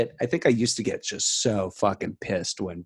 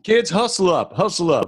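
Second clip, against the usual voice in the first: high calling coach voice; mock coach call; 8 semitones higher than usual.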